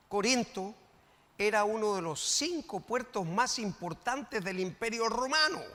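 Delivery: ordinary delivery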